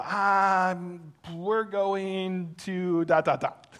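slow, drawn-out hesitant mock excuse; stalling excuse. Pastor acting his excuse to the neighbors, drawn out and hesitant. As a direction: stall for time, hammed-up.

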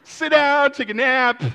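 high, loud voice of a protesting body; body giving up. Acted. Runner's own body quitting at mile eight, high and loud. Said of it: "my body goes".

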